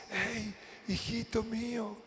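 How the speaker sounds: slow, hushed fatherly murmur; soft, tender call. Acted. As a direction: call gently to a little child, slow and soft. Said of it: "te susurra y te habla despacito".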